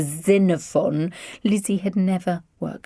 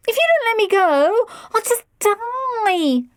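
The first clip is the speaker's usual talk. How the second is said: put-on whining teen; 4 dB louder than usual. A narrator's reading. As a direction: whine like a spoilt teenager. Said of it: "Lydia whined".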